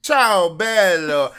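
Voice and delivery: slow, loud, hammed-up Italian "Ciao, bello"; mock Italian. Echoing the host's Italian greeting with a mock Italian flair. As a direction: drawl it like a mock Italian charmer.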